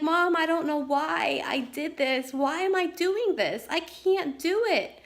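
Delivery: high, tearful, despairing plea; crying daughter. Retold or imitated. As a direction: imitate a teen sobbing in despair. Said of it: "she's bawling her eyes out".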